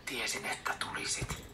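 a weak father's hoarse murmur. A narrator's reading. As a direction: croak it out in relief. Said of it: "isä sanoo käheästi".

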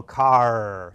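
"car" held out on its ending; final R demo. Shown as a demonstration. Coach shows the R at the end of "car", drawn out. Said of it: "don't say their R's at the end".

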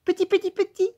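very high sing-song cooing; cooing pedant. Acted. Teacher coaxing the boy, very high cooing. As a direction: coo at the little boy like at a chick.

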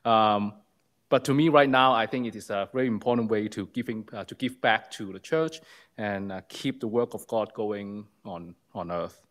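ordinary delivery

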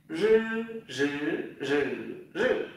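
"Rư" buzzed with tongue tip curled, through four Mandarin tones; curled-tongue voiced r. Shown as a demonstration. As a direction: curl up and voice each "rư", changing tone. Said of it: "Đầu lưỡi cuộn lên này". (đọc "rư" cong lưỡi, rung dây thanh)

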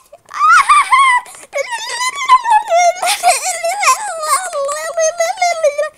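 high, long, wobbling babble-cry; wild play-acted wail. After I shoot him in the chest, wild mock wail. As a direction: let loose a long, high, wavering noise.

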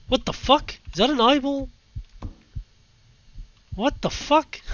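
shocked, high-pitched disbelief; startled. Real, not acted. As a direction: blurt it out, freaked out. Sees an eyeball, startled.

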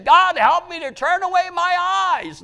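high, loud, sustained cry; desperate cried-out prayer. Acted. Preacher showing what David should have prayed, loud desperate cry. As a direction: shout the prayer at the top of the range.

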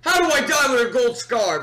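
sharp angry yell; raging gamer. Real, not acted. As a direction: shout it in frustration. Dying in game, shouting.

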